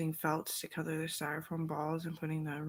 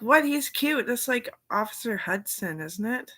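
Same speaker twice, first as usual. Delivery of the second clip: put-on higher, louder, coaxing the kids; excited protest; 5 semitones higher, 7 dB louder than usual. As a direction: insist Santa is cute, louder. Defending Santa to the kids.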